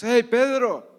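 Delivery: loud, high call from across the water; the Lord calling to Pedro from the shore; calling from afar. Acted. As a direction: shout it out over the lake. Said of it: "escuchan una voz a lo lejos".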